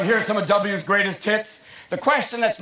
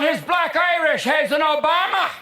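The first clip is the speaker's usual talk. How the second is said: high shouted Irish exclamation; hammed-up Irish cheer; 5 semitones higher than usual. Irish people claiming Obama as their own, mock Irish shout. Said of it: "Irish people are going".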